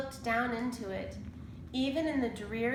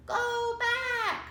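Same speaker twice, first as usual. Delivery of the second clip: much higher parrot squawk; 4 dB louder than usual. A narrator's reading. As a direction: screech it like a parrot. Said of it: "squawked Polly".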